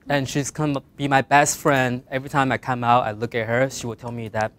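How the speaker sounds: ordinary talk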